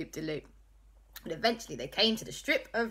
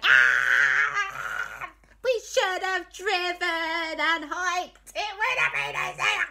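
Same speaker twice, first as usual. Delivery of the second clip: panicked cry; 12 semitones higher, 6 dB louder, slower than usual. Acted. Everyone panics in rapids.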